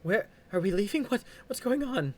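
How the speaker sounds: high, loud frantic questions; panicked questioning. Acted. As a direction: demand answers in panic. Wife confronts leaving group.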